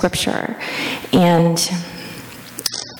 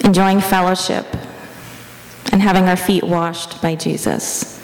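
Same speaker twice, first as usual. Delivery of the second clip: even, level prayer-meditation voice with long pauses; guided meditation. Real, not acted. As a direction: guide them gently into the imagined scene, leaving space between phrases.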